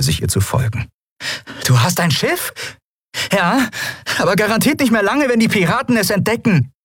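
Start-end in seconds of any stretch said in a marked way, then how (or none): 1.7-6.7 high, urgent exchange on the run; strained high-pitched yelling, held in volume; shouting over noise. Acted. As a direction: call it out over roaring engines. Shouted exchange amid engine roar.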